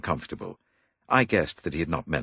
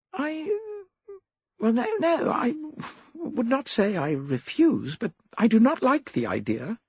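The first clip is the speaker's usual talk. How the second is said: high, flustered faltering; flustered girl, higher; hesitant young woman. A narrator's reading. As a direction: stammer it out uneasily.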